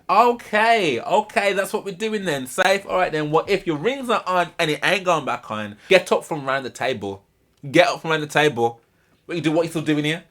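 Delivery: high, loud furious yell at the husband; imitating furious wife. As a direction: shout him away from the table, mimicking her. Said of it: "Amy loses her mind".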